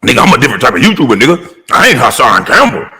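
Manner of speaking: fast, lightly put-on tough-guy voice; a hint of a bragging rival, fast; mock threatening YouTuber. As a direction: rattle it off like a rival trying to sound hard.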